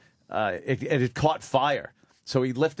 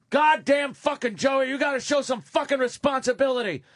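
boss yelling; 11 semitones higher than usual. Retold or imitated. Boss chewing out the dad behind the office door, furious yell. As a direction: bellow at the employee like an enraged boss. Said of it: "all I heard was Joachim going".

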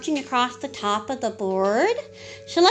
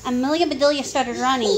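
plain reading voice

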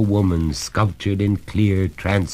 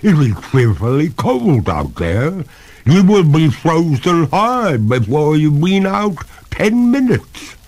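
higher, louder ghostly protest; reluctant; 10 semitones higher, 6 dB louder than usual. A narrator's reading. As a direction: plead against going out into the cold. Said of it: "with a pale reluctance".

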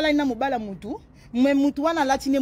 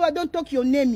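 ordinary delivery

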